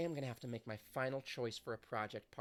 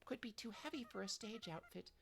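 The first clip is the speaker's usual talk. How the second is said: put-on sweet idol girl; 9 semitones higher than usual. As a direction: gush kindly like a gentle pop idol. Voices sweet idol character.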